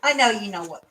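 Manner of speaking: ordinary talk, nothing marked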